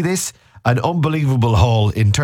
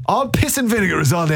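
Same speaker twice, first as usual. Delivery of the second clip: higher, hammed-up outrage at the lads; mock fuming rant; 8 semitones higher than usual. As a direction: rant like a scandalised fan, pitched up. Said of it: "we're absolutely fuming".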